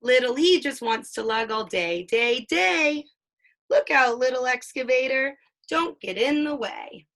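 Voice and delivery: lively picture-book read-aloud, playing up the sound words; reading to a child. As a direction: read it like a bedtime book for a toddler.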